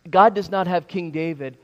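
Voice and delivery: normal talking voice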